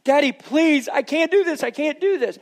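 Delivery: put-on high, desperate child's plea; child pleading. Son crying during stapling.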